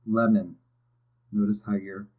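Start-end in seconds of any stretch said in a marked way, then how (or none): 0.1-0.6 "Lemon" with LEM loud and ON light; stress first syllable. Shown as a demonstration. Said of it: "chin hits your hand for the first syllable".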